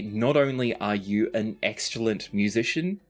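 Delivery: ordinary talk, nothing marked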